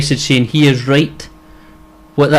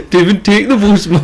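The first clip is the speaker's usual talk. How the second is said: high Geordie catchphrase; Geordie accent; 6 semitones higher than usual. Retold or imitated. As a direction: ham up the Geordie phrase. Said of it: "Devon means don't".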